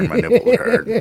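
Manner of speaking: squeaky falsetto joke; high mock squeal. Joking he's turned on.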